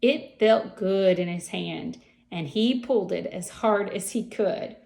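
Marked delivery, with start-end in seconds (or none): none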